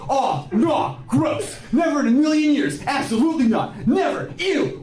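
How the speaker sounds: high, squeamish protest; disgusted dude. Retold or imitated. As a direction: act grossed out and panicky. Mocking homophobic dudes.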